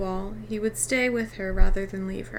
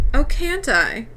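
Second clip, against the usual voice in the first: defiant; 6 semitones higher, 6 dB louder, slower than usual. A narrator's reading. Defiant boy challenges his father.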